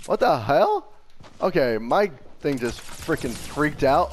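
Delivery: ordinary delivery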